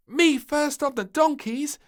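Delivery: high, loud, cheeky claim; grinning eagerly. Acted. Little Princess claiming the donkeys, high cheeky call. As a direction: call dibs with a grin. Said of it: "with a grin".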